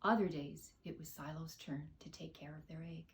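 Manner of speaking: plain reading voice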